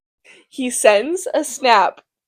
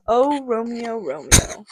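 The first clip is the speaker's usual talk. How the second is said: dreamy sigh; 5 dB quieter than usual. Acted. Juliet sighs for Romeo.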